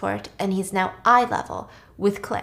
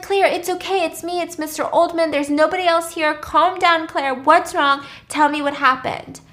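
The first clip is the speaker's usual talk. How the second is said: urgent reassurance; 7 semitones higher, 3 dB louder than usual. Retold or imitated. Virgil urgently reassures distressed Claire.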